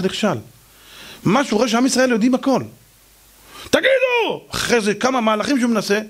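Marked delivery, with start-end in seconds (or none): 3.7-4.5 calling out. Acted. Calling out to the crowd.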